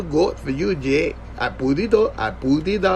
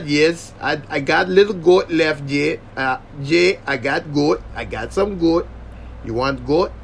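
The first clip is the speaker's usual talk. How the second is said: lightly voiced Jamaican cook offering goat; a hint of a Jamaican cook calling to Jay; imitating my guy. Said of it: "That's how my guy talks".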